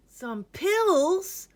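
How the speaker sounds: put-on squeaky high boy's voice, startled; high alarmed child. Ned squeaks in alarm at the doctor.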